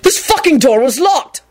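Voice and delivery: high-pitched exasperated outburst; shouting. A narrator's reading. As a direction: protest it angrily, voice up. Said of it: "Quentin shouted".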